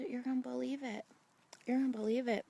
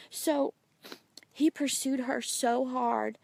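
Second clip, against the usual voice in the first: loud, impassioned testimony with raised pitch; fervent preaching; 4 semitones higher, 6 dB louder than usual. Real, not acted. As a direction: preach it out with full conviction.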